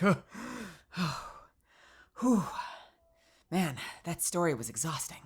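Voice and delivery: soft, winded, with puffs of breath; worn out from talking. Real, not acted. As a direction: catch your breath between phrases. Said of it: "out of breath from talking".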